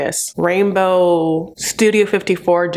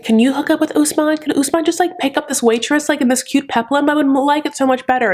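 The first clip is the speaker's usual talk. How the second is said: high, eager, lightly pleading; gushing high plea; 8 semitones higher than usual. Retold or imitated. Vlogger begging Usman to pick the waitress, in a pitched-up plea. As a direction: coax him sweetly, pitched up.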